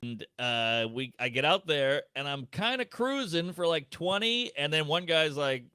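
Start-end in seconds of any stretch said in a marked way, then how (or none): none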